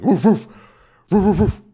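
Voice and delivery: quick high imitated woofs; chasing dog barking. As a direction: bark like a dog in pursuit. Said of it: "the dog who's chasing him, going".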